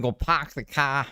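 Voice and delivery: a hint of Boston on go park the car, slow; half-hearted Boston accent. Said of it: "Let me hear her Boston accent".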